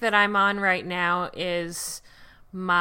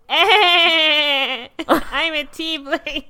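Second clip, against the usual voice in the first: laughing; 3 semitones higher, 4 dB louder, much slower than usual. Pretend. Playful tiefling line, laughing.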